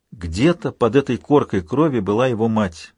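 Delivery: usual speaking voice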